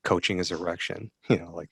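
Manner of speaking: normal talking voice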